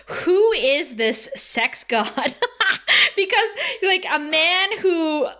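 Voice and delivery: giggling through the question; laughing. Real, not acted. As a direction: laugh it out, slow and delighted. Gushing about Giles, laughing.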